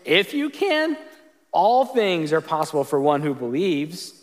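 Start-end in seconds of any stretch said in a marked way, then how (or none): 0.1-1.0 If you can thrown out high and sharp, can stressed; exclamation point. Shown as a demonstration. Jesus echoing the father's doubt, sharp exclaimed retort. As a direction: snap the words If you can back like an exclamation. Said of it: "exclamation point".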